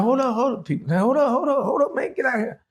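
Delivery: high, rushed alarm, telling him to get out; a panicked homeowner waving him off; high, frantic get out; alarmed hold up. Retold or imitated.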